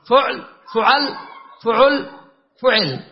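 فعل four ways: "fuʕl, fuʕal, fuʕul, fuʕil"; u on first letter. Shown as a demonstration. Teacher running through u-first patterns, "fuʕl, fuʕal, fuʕul, fuʕil". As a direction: keep u after f, change the middle vowel each time. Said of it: "ضم فاء ال".

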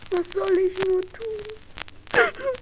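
slow, tearful plea, voice up high; weeping. Acted. Daughter alone with the straw, crying slowly. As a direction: cry it out helplessly.

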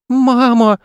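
put-on high-pitched child calling for mum; tearful boy. A narrator's reading. Boy tumbling alone in space, tearful. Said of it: "И горько заплакал".

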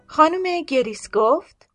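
ordinary delivery, nothing marked

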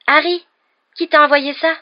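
high and loud, gasping in surprise; stupéfaite. Acted. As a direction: blurt it out, amazed. Hermione stupéfaite devant le balai. (Hermione stupéfaite, voix haute et forte)

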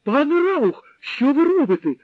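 high, loud panicked plea; scared girl's high loud plea; frightened cry. A narrator's reading. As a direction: beg him to stop in terror. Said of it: "тільки й могла скрикнути вона".

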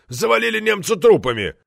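loud, high outburst of outrage; roaring. A narrator's reading. Committee member outraged at slander of the war, roaring. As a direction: roar it indignantly, high and forceful. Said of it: "взревел Борозда".

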